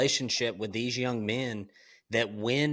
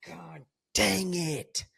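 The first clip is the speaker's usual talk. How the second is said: high, drawn-out retold exasperation; exasperated groaning; 7 semitones higher, slower than usual. Father must follow through on threat to son, exasperated. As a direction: whine it in mock despair, pitch climbing.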